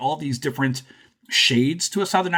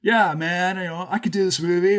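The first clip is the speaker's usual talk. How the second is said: put-on easygoing actor voice, a bit higher; Nicolas Cage; 5 semitones higher than usual. Podcaster imagining the actor taking the movie, lightly voiced. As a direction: lightly act an actor agreeing to a part. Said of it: "Nicolas Cage goes to this movie and says".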